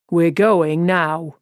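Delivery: firm, slow and final; no argument. Acted. Father ends the discussion with his son, firm and slow. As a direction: lay down the law, slowly. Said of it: "in a tone that brooked no argument".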